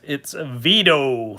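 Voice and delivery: Vito, slowed and stretched in a put-on Chicago accent; a bad Chicago accent on the name Vito; mock Chicago accent. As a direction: say Vito like a Chicago local, drawn out. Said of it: "if you say it with the Chicago accent".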